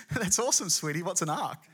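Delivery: mock-supportive sweetie voice; humouring wife. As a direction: gush first, then ask cluelessly. Wife humours Noah's strange news.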